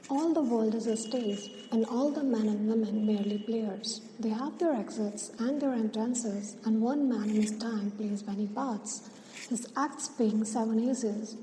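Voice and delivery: soft, hushed verse reading; poem recitation. Acted. Teacher reciting Shakespeare's verse to students, soft poem recitation. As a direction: recite the verse quietly and evenly.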